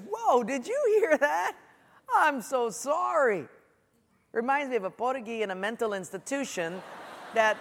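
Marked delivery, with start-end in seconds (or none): 0.1-3.5 high, slow, drawn-out mock concern; exaggerated mock apology. Preacher retelling his reply to touchy acquaintance, exaggerated apology. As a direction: overdo the shocked apology, stretching it out.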